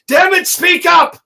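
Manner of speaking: high, loud, slowed yell of frustration; frustrated shout. Retold or imitated. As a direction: bellow it at your friend to speak up. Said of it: "in that moment I go".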